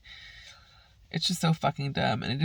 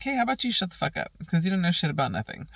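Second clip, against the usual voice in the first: retold snapping back; 3 semitones higher, faster than usual. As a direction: rattle off the put-down, fast and sharp. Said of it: "And I'm like".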